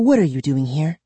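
a touch of distrust; suspicious. A narrator's reading. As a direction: question them with mild suspicion. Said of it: "eyeing us suspiciously".

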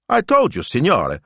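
high-pitched, loud exasperation; exasperated. A narrator's reading. Young assistant answers her worried boss, exasperated. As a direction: protest as if it's obvious. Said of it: "sounding a little exasperated".

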